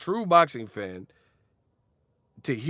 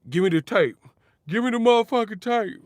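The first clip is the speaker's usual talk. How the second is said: mock demand; 9 semitones higher, 6 dB louder than usual.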